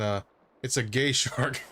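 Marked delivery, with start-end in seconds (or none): none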